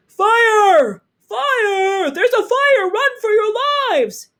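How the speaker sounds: loud, high shout of fire; shouting alarm. A narrator's reading. Man warning the building of fire, frantic shout. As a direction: yell the warning.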